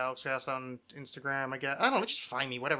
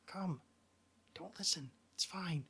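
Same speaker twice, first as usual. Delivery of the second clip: hushed, intimate, menacing murmur; creepy soft whisper; 6 dB quieter than usual. Pretend. Host to one listener, mock cult whisper. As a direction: lean in close and breathe it like a secret. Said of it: "I'm not gonna say that other part".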